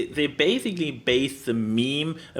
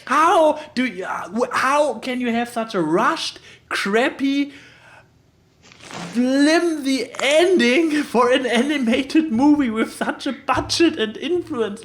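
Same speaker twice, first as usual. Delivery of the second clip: incredulous outburst; 8 semitones higher than usual. Real, not acted. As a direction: protest in disbelief. Indignant rant about ending.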